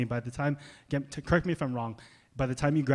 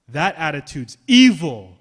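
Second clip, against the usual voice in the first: loud condemnation; 3 semitones higher, 14 dB louder than usual. Real, not acted. Preacher condemning.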